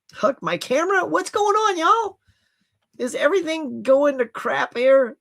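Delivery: high-pitched exasperated question; exasperated outcry. Real, not acted. Camera freezes during livestream.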